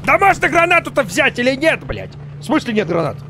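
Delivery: high, loud exasperated yell; frustrated shout. Real, not acted. No grenades, frustrated.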